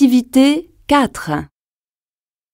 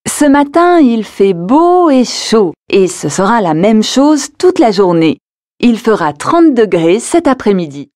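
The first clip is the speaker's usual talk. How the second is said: louder, bright forecast read; weather forecast; 2 semitones lower, 3 dB louder, faster than usual. Acted. (bulletin météo)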